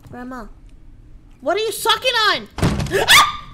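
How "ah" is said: high, loud startled scream; real startled shriek. Real, not acted. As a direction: shriek in fright. Scream at jump scare.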